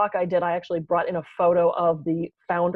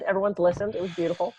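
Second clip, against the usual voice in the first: ordinary talk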